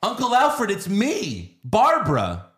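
high, loud mock-girlish cry; Barbara. Comic imitating her greeting the robot butler, high mocking cry. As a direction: squeal it in a hammed-up falsetto. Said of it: "And she goes".

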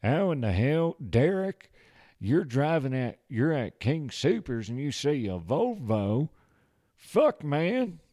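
loud, high-pitched cowboy voice. Acted. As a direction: holler it like a hammed-up cowboy. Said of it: "Cowboy's an easy one to get into".

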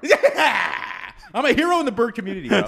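loud, fast, laughing brag; giddy hero boast. Real, not acted. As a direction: crow about it through laughter. Celebrating being a hero.

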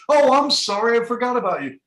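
mock coach apology. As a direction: toss off a careless apology as the old coach. Retold coach forgetting to play him.

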